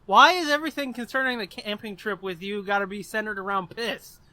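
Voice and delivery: ordinary delivery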